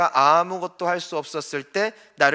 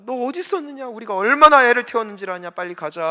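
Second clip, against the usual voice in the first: high-pitched scolding mother, loud burst in the middle; worried mother scolding; 7 semitones higher, 7 dB louder, faster than usual. Acted. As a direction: act out a frantic mother's reproach, rising then easing off. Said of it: "어머니가 말하죠". (애태운 어머니가 높은 목소리로 나무라듯)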